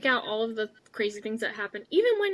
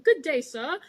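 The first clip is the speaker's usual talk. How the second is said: mock British accent; 5 semitones higher, 5 dB louder than usual. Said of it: "almost a mocking British accent".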